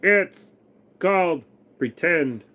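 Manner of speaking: silly voice. Pretend. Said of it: "when I make the voices".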